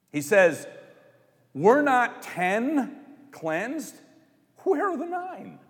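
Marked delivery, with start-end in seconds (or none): 1.5-5.6 slow, higher-pitched disappointed demand; exasperated disappointment; emotional, annoyed Jesus. Acted. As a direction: ask it like a let-down teacher counting heads. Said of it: "That's me, you know, inserting some emotional".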